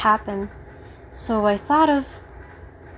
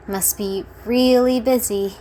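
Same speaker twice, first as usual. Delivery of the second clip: ordinary delivery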